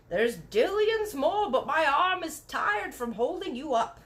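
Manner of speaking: a hint of a gentle giant; lightly voiced giant. A narrator's reading. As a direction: speak as the BFG, musing. Voicing the giant.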